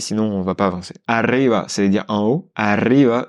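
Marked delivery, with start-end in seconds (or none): 1.1-1.6 "Arriba" with a strong trilled rr; rolled r. Shown as a demonstration. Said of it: "on le force un peu plus parce qu'il y a deux R". 2.5-3.2 "Arriba" with a forced trilled rr; rolled r. Shown as a demonstration. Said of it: "on le force un peu plus".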